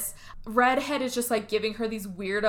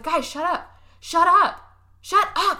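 rising higher and louder each time; mock exasperated shushing; 6 semitones higher, 5 dB louder, slower than usual. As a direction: imitate someone demanding silence, escalating. Acts Overreactor silencing the others.

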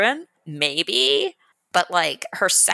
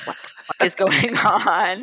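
louder quote broken by laughter; laughing mock disbelief; 3 semitones higher, 4 dB louder than usual. Workers dropping their tools at the duke's remark, laughing disbelief. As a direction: act out baffled onlookers through a laugh.

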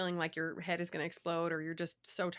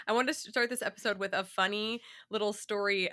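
normal talking voice

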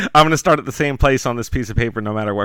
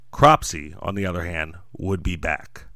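suspenseful crime show narrator; 5 semitones lower than usual. A narrator's reading. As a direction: drop the voice to an ominous hush. Ominous closing line.

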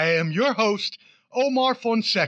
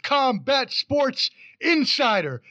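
slower, higher and louder; ring announcer; 6 semitones higher, 3 dB louder than usual. Acted. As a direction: call the name out like fight night. Announcing guest again.

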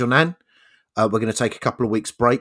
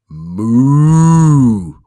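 a long, loud drawn-out moo; loud cow moo; 10 dB louder than usual. Acted. Friend telling cow joke, moos loudly like a cow. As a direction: moo like a cow in a field, full voice.

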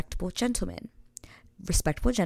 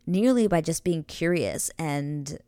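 ordinary delivery

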